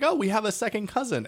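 mildly surprised, recounting your reaction; retold surprise. Retelling own reaction to news.

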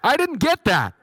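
mock phone bot that didn't catch it; automated phone system. Phone menu failing to hear the caller, mock robotic. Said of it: "more impressions of DirecTV people".

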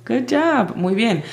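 ordinary delivery

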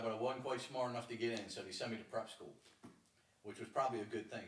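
normal talking voice

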